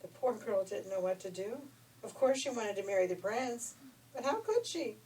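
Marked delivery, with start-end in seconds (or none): none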